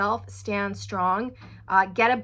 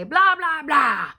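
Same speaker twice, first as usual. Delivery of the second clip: louder, higher mock scolding blah; hammed-up domineering blah blah; mock nagging; 5 semitones higher, 7 dB louder, slower than usual. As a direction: imitate a partner barking nonsense at you. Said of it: "they chip away at you and they say".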